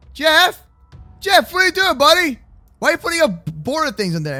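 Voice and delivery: ordinary delivery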